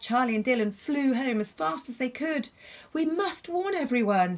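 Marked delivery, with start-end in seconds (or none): 2.9-4.4 put-on urgent girl. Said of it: "said Charlie as they flew".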